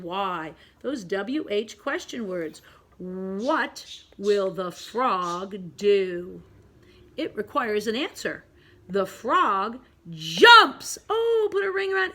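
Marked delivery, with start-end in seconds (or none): 3.0-6.4 drawn-out question, word by word; slow read-along. Shown as a demonstration. Teacher reads a question to pre-K pupils, slowly. 8.9-11.0 slow build to a loud JUMPS; loud exclamation. Shown as a demonstration. Teacher models an exclamation point for pupils, loudly. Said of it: "Big, loud exclamation point".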